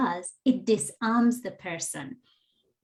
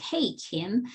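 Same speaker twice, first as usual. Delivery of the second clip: softer, friendly hail; gentle calling in; 5 dB quieter than usual. Shown as a demonstration. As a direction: greet her like a friend you trust. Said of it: "coming at it like".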